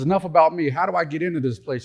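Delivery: a hint of a pushy husband; eager husband. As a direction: rush past the bad news to ask his way in. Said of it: "He says".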